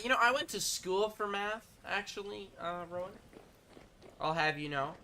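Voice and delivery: high, huffy, offended pride; wounded vanity. Acted. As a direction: puff up, stung and defensive. Indignant reply to math teasing.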